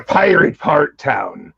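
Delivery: low, drawn-out pirate voice. Pretend. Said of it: "somebody's grandmother sounds like that here".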